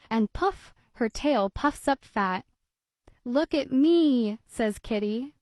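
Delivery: put-on lively read-aloud voice for children; storybook reading. A narrator's reading. Narrator reading a kitty tale to children, lively storybook voice. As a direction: read it like bedtime story time. Reads picture book aloud.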